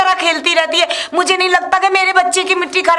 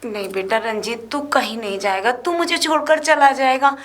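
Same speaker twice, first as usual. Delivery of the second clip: much softer and lower, begging her son not to go; pleading mother; 5 semitones lower, 5 dB quieter than usual. Acted. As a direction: hold him back with a hushed plea.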